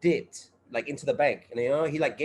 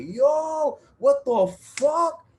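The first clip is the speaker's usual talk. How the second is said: much higher, slow drawn-out what the fuck; stunned disbelief; 11 semitones higher, 4 dB louder, much slower than usual. Retold or imitated. Telling a friend how he reacted to the bank story, in high, slow, stunned disbelief. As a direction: let the disbelief stretch every word. Retelling his shocked reaction.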